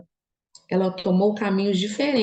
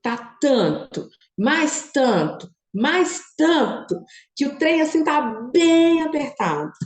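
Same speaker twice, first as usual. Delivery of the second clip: slow, rising Minas Gerais lilt on "tanto", building higher; Minas Gerais accent; 8 semitones higher, slower than usual. Retold or imitated. Talking to her followers like a mineiro, slow rising Minas Gerais accent. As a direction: stretch "tá tanto, mais tanto" like a mineiro, climbing each time. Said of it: "Eu falo igual mineiro".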